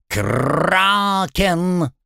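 high squawked parrot word; bird folk. Acted.

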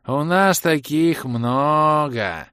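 slow, loud, drawn-out brush-off; careless dismissal. A narrator's reading. Bald elf shrugs off the broken bottle, carelessly drawling. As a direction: wave it away, stretching the words. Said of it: "Небрежно отмахнулся лысый эльф".

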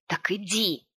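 mildly snapped command; a restrained bark; barked order. A narrator's reading. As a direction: snap it without shouting. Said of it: "рявкнул мужчина".

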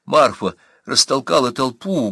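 ordinary delivery, nothing marked

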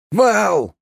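high, loud battle cry; yelling at full voice. Acted. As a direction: scream it with the whole throat. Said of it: "во всю глотку заорала".

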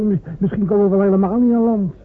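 agitated. Acted. Anxious soldier before boarding.

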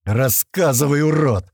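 angry shout at the captive; злой окрик. A narrator's reading. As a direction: bark it like a thug interrogating. Бандит допрашивает пленника. (грубый злой окрик)

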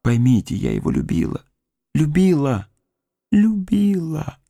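Любила! climbs to a cry; rising cry of love. Acted. Grieving woman declares she loved him, climbing to a cry.

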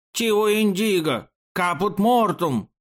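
high, drawn-out lament; tearful wailing. A narrator's reading. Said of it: "плачущим голосом художник". (плачущее причитание, высоко и протяжно)

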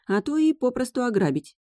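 usual speaking voice

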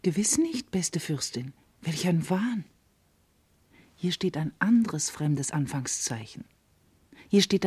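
soft, whispered denial; hushed whisper. Acted. Karis to the princess, hushed denial. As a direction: deny it gently under your breath. Maid contradicts princess secretly.

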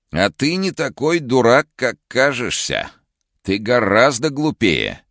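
high, slow, eerie villain voice; sinister other voice. Acted. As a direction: sneer it in an uncanny changed voice. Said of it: "каким-то другим зловещим голосом".

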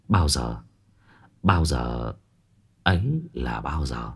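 low, soft, scheming murmur; scheming inner thought. A narrator's reading. Lý Thuần privately plotting over the village land, low and soft. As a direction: brood over it quietly, counting the days. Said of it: "Trong lòng đang tính toán".

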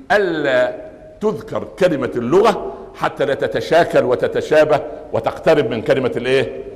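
normal talking voice

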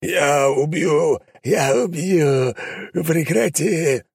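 shrieking demon. Acted. Demon shrieks at the hunter to stop. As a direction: screech the threat in pain. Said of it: "демон заверещал".

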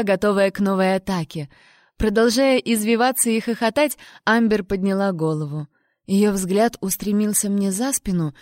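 ordinary delivery

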